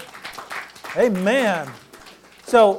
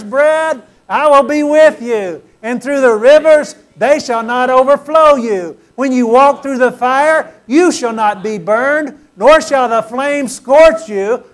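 louder, building, fervent proclamation; say it like you mean it; 6 dB louder than usual. Shown as a demonstration. Pastor leading the church in reading with their names, fervent and loud. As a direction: claim every word like it's yours, louder and louder. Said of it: "And say it like you mean it".

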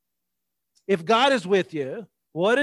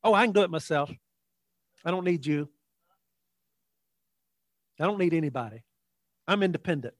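softer, low-key dismissive voice; self-reliant refusal; 3 semitones lower, 4 dB quieter, faster than usual. Acted. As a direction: wave off help, quietly. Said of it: "how many times have I heard that".

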